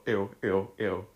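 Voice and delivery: usual speaking voice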